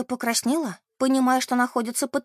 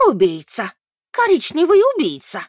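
high, loud cry of accusation; strong loud old lady; 5 semitones higher, 7 dB louder than usual. A narrator's reading. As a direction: ring it out across the room. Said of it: "очень сильный и громкий голос леди Брайтли". (громкий крик старой леди)

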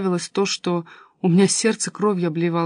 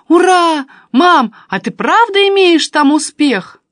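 high, loud delighted cry; excited cheer; 9 semitones higher, 9 dB louder, slower than usual. A narrator's reading. Daughter cheering.

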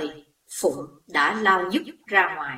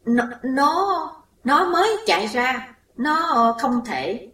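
dazed, delirious; 6 semitones higher than usual. A narrator's reading. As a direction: speak as if in a daze, not believing it. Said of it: "nói như người mê sảng". (nói như người mê sảng)

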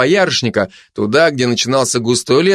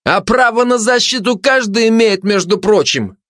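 high-pitched indignant objection; high, insistent self-defence; interrupting protest; 10 semitones higher than usual. Acted. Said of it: "перебил предатель".

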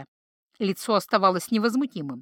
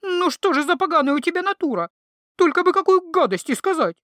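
much higher, louder reproach; indignant scolding; 8 semitones higher, 4 dB louder than usual. A narrator's reading. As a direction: scold the troll, fed up. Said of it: "возмутилась знахарка". (возмущённо отчитывая)

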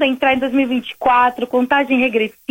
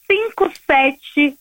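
slow dictation; slower than usual. Real, not acted. Dictating the WhatsApp number slowly.

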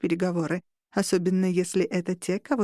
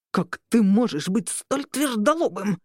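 narrated growl of frustration; exasperated growl. A narrator's reading. Robert berates the stubborn marshal, growling in exasperation. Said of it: "зарычал Роберт".

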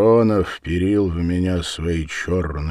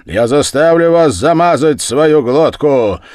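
high, loud angry shout; enraged, raised voice; furious threat; 9 semitones higher, 12 dB louder than usual. Acted. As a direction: bellow a threat at a rival.

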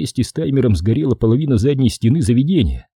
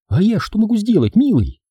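fawning; 10 semitones higher than usual. Acted. Brandy fawns on boss.

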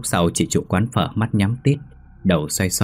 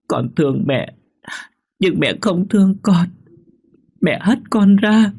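put-on high, tearful child complaining; crying ghost child; 11 semitones higher, 3 dB louder than usual. Ghost child tells mother of cold, high and tearful. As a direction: whimper like a cold little child. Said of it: "cứ thế mà khóc mà dỗi".

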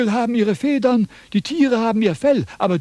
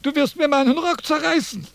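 alarmed protest; 8 semitones higher than usual. Acted. Philosopher grabbed by his robe, high alarmed cry. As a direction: yelp to be released.

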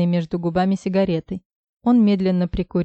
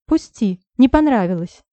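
«не понравилось» flung out higher and louder; angry denial; 4 semitones higher, 5 dB louder than usual. Acted. Heroine shoves Misha away, denying loudly. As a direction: push him off with the words.

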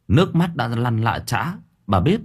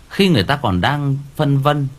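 ordinary delivery

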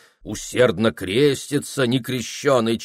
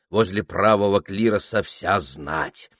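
ordinary delivery

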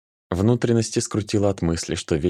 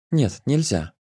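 put-on tender girl voice; 6 semitones higher, slower than usual. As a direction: coo it, pitched high. Nika, high girl voice.

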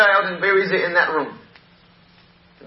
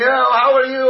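higher, louder call through a door; retold yelling through a door; 6 semitones higher, 5 dB louder than usual. Shouting a greeting to the woman through the door. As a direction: call out as if across a shut door.

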